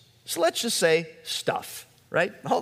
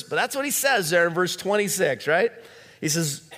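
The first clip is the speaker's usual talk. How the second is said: ordinary delivery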